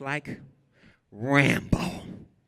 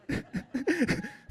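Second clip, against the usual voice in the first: high bright laugh; released man laughing with joy; relieved laugh; 5 dB quieter than usual. Real, not acted.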